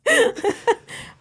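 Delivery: high burst of laughter. Real, not acted. Laughs at accent impression.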